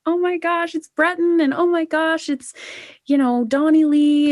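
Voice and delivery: high, gushing fan voice, each name a thrill; excited squeal. Retold or imitated. Singer recalling awards night with family, high excited gush. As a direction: squeal over friends' names on screen. Retold watching nominees pop up.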